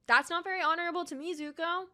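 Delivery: high, fast scolding of Zuko; exasperated reprimand. Real, not acted. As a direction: tell him off with disbelief.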